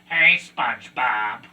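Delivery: droning cartoon squid voice; Squidward voice.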